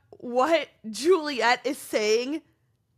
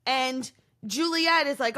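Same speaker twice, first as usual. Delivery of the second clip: ordinary delivery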